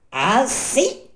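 high, loud puppet outburst; angry cry. Acted. Pinocchio enraged at the closed door, angry high cry. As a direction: cry out high in rising rage. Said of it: "sempre più accecato dalla collera".

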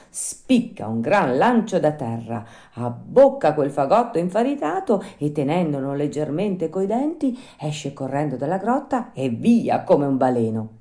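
ordinary delivery, nothing marked

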